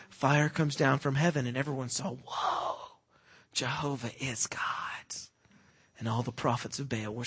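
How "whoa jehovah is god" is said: hushed, breathless whisper of wonder; awed whisper. Retold or imitated. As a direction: whisper it in awe. Crowd awed by fire.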